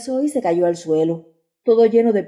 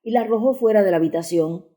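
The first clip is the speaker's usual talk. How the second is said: ordinary delivery, nothing marked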